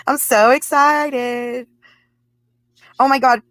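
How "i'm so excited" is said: very high, slow, stretched-out squeal; squealed excitement. Acted. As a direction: squeal it out like a giddy fangirl. Giddy over twin flame.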